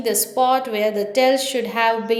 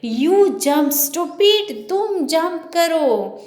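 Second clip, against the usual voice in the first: angry shout; 6 semitones higher, much slower than usual. Retold or imitated. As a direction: bark the order in exasperation. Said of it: "जोर से चिल्लाते".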